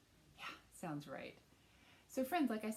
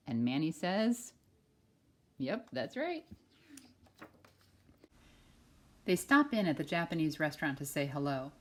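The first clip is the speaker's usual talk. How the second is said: loud, bright storybook read-aloud; reading to children; 12 dB louder than usual. Acted. Teacher reading a picture book to kindergartners, loud and lively. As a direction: read it big for a class of kids.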